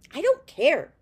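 put-on loud, high defiant yell of a stubborn boy; defiant shout. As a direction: shout it back at him, refusing to give in. Boy defies the old man.

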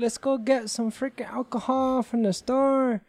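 imitating New Mexico locals. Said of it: "they're like".

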